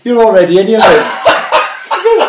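giggling through the embarrassing story; laughing. Real, not acted. Retelling embarrassing bouncer moment.

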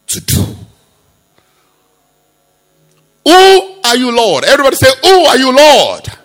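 high, loud dramatic retelling; preaching at full force. Acted. Preacher acting out Paul's fall, loud and high. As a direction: cry it out to the crowd.

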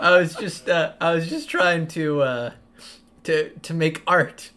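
stammering guilty denials. Acted. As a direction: fumble for an excuse, caught out. Hal caught, stammering excuse.

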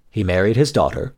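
usual speaking voice

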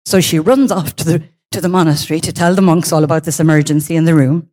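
usual speaking voice

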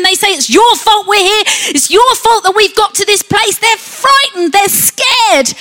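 high, loud panicked accusation; frightened shouting. Acted. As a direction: shout it in fear and fury, blaming Moses. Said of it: "they start shouting to Moses".

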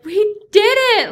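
loud, high victory cry; hammed-up jubilant yell; retold triumph. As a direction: shout it like crossing the finish line. Retells triumph at finish line.

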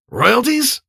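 high, rising one-word question; incredulous. A narrator's reading. Stoddard queries Lowell's motive, incredulous. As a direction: echo it back high, in disbelief.

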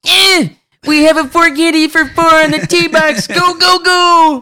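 very high, loud cartoonish holler; excited mock yell. Golfer rallying everyone on the tee box, high excited mock yell. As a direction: imitate someone hollering in a high-pitched frenzy. Acted quote of excited caller.

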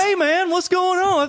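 high-pitched hollered greeting; mock oblivious customer greeting; imitated stranger calling out. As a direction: holler it high, as the unexpected visitor.

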